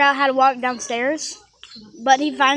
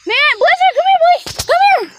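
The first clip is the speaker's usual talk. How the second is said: very high, loud summons; dog call; 12 semitones higher, 8 dB louder than usual. Real, not acted. Owner calling the dog over, high loud call.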